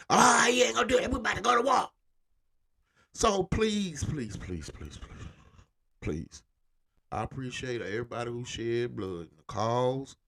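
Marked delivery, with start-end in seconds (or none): 0.1-1.9 higher, loud mocking shout. Voicing the South shouting back at the North, high and loud. 3.1-6.4 fading please. Real, not acted. Begging viewers to drop the narrative, fading pleas. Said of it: "fading pleading repetition".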